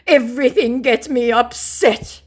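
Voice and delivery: high, loud put-on wail; mock lament. Scammer bemoaning it all to the target, mock lament. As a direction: ham up the scammer's upset. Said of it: "he laments".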